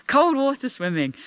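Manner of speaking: ordinary talk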